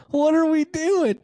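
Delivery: high, loud squeal through laughter; incredulous laughter. Real, not acted. Podcast host reacting to a hopeless team's scores, incredulous laughing squeal. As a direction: squeak it out in helpless disbelief. Cracking up over terrible scores.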